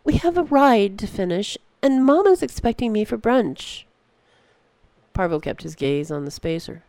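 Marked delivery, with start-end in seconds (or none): 0.0-3.9 put-on a higher, louder girl's voice pressing him; impatient girl. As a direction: urge him on like a restless young girl. Girl urges him to leave.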